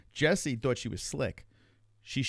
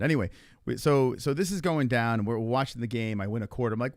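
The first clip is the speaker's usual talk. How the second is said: normal talking voice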